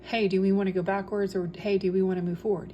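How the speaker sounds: normal talking voice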